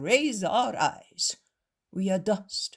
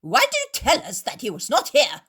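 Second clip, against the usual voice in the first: loud, high furious accusations; shouting; 10 semitones higher, 9 dB louder than usual. Acted. Proud Pharisees accuse the host, furious shouting. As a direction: hurl it at him like an outraged zealot.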